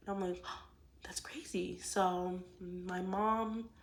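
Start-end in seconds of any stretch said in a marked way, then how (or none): 0.7-1.8 sharp gasp, then a hushed whisper; breathless whispered disbelief. Retold or imitated. Shocked reaction retold, whispered.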